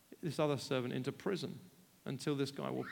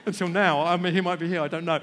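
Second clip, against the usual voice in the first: loud, laughing through the words; laughing confession; 10 dB louder, faster than usual. Real, not acted. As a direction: chuckle at your own confession.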